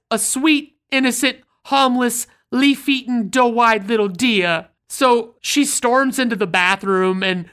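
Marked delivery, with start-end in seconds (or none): 0.1-4.7 higher, pleading New York voice, slowing on the last words; outraged New York accent. Retold or imitated. To Vinny about shooting a deer, outraged New York accent. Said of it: "She says".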